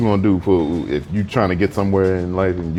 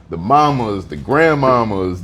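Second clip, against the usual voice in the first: ordinary delivery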